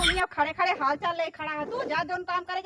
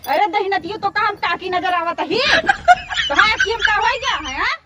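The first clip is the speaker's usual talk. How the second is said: angry outburst; 3 semitones higher, 10 dB louder than usual. Acted. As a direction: explode at being called auntie. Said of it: "अरे काकी काहे गुस्सात यहा?".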